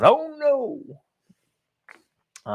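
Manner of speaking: sarcastic high oh no; mock dismay. Host mocking shutdown coverage with sarcastic fake alarm.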